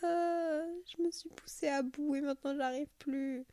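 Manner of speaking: high, worn-out burnt-out voice; exhausted whine. Retold or imitated. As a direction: sound totally drained and whiny. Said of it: "je vais vous prendre sur le podcast en disant".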